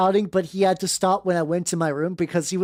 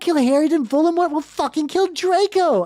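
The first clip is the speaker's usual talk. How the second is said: fast, higher, panicked [name]; frantic plea; 9 semitones higher than usual. Acted. [name] pleading fast and high about killing Harry.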